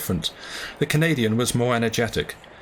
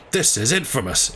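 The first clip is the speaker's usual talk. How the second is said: indignant exclamation. Acted. The harpooner bursts out at being locked in again, mildly indignant. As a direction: snap it out, fed up. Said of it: "exclaimed Ned Land, who burst out".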